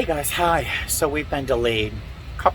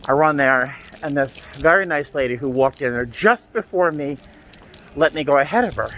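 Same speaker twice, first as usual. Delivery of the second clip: ordinary delivery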